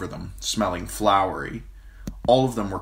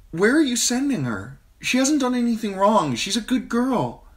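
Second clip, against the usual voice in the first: near tears; 5 dB louder than usual. A narrator's reading. Sansa near tears, pleading.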